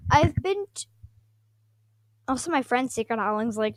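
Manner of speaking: usual speaking voice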